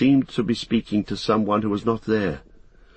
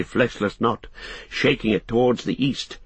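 ordinary delivery

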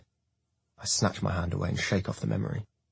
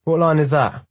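higher, louder retort; loud challenge; 8 semitones higher, 8 dB louder than usual. A narrator's reading. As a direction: fire back hard. Hartman challenging Jackson.

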